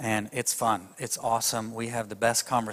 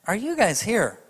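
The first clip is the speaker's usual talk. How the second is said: ordinary delivery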